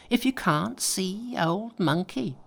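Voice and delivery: high, slow cocky taunt; Monkey taunting. A narrator's reading. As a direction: drag out the boast. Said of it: "shouted Monkey".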